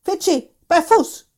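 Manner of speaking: loud, high shouted command; dog command. Acted. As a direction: yell heel at the runaway dog. Hubert commands the fleeing dog.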